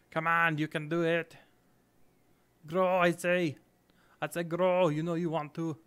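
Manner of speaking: loud, badly faked Arnold Schwarzenegger-style accent; wannabe Arnold accent. Gamer urging the tree to grow, wannabe Arnold accent. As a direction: urge it on in a hammed-up Arnold accent. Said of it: "It's like a wannabe Arnold accent".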